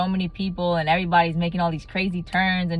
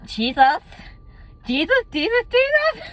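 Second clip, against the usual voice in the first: each Jesus climbing much higher; building from a plea to a squeal; rising desperate plea; 3 dB louder than usual. Retold or imitated. Desperate plea while driving.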